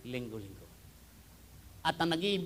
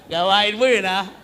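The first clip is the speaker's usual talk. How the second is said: laughing; 5 semitones higher, 14 dB louder than usual. Real, not acted. Laughing at own story.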